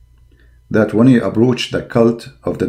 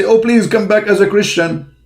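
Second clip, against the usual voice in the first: high, whiny imitation begging; mock pleading; 10 semitones higher than usual. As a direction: beg like a beaten opponent, mockingly. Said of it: "they say".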